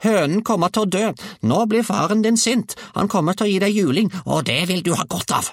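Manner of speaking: put-on very high, loud old-woman tirade; scolding grandmother. A narrator's reading. Grandmother warns boy of father's beating, high scolding. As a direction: threaten him in a high screech.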